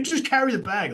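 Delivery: brushing off the caddy in a mock Barkley voice; imitating Barkley. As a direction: toss it off like a star who won't discuss it.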